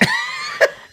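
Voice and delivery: sudden high squeal of laughter; high shrieking laugh. Real, not acted. Laughing at terrified friends.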